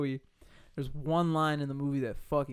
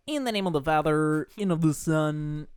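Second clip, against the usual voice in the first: weird bishop voice; 6 dB louder than usual. Retold or imitated. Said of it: "the weirdest voice I'd ever heard".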